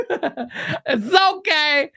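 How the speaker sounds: high-pitched, laugh-choked teasing; laughing mockery. Real, not acted. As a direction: draw it out through gleeful laughter. Laughing at the coming fan meltdown.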